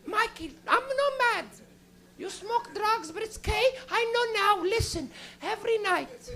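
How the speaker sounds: put-on high Portuguese accent, gently knowing; Portuguese mother with a heavy accent, gently telling her son she knows; Portuguese mom. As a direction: reassure him you are not mad. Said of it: "She goes".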